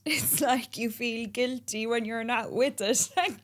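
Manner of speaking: high and loud, laughing through the words; real laughter. Real, not acted. To the host, laughing about the business being a child. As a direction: laugh at the comparison.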